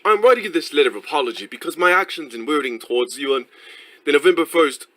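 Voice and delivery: hammed-up English accent for a formal letter; demonstrated English accent. Shown as a demonstration. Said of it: "It would be like".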